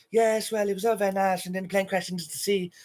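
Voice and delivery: ex-fiancée voice. Retold or imitated. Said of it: "they'll be interviewing her".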